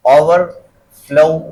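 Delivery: overflow very slow, flow set apart and stressed. Shown as a demonstration. Teacher showing compound verb stress, stress on flow. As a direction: pause after over, then stress flow. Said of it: "flow पे आ गया".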